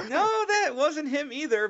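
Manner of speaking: mock innocent denial.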